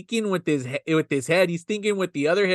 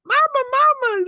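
put-on high-pitched child calling out; little girl; 3 dB louder than usual. Little girl runs into the house, excited child voice. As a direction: call for mother in a put-on child's voice. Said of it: "She goes in the house".

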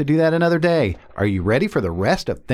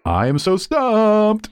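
goofy voice; 7 semitones higher than usual. Acted. Pun voice sting.

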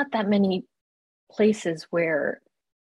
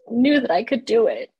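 ordinary talk, nothing marked

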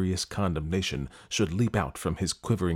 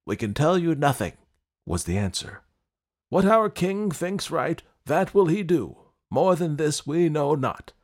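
attendants; 6 semitones higher, 4 dB louder than usual. Acted. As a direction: answer with servile caution. Attendants answer prince.

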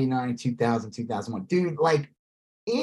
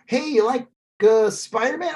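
quizzing fan; 8 semitones higher than usual. Retold or imitated. Voicing fan quiz dialogue.

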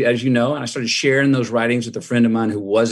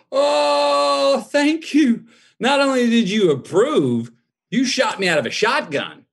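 overjoyed cry; 11 semitones higher, slower than usual. Real, not acted. Son thanking his father for his blessing, overjoyed. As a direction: cry out with thanks like a weight just lifted.

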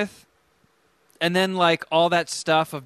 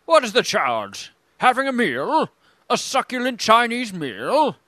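indignant Aussie; 6 semitones higher than usual. Retold or imitated. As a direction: protest like the arrested guy. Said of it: "that guy getting arrested".

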